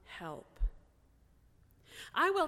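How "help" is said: low, much softer cry for help; quiet plea. Retold or imitated. Preacher voicing a possessed man's plea, low and hushed. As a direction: let the word sink almost away. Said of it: "but with cries of".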